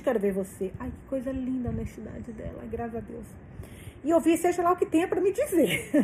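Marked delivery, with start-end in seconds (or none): 4.0-6.0 laughing. Real, not acted. Reader laughing at the romance.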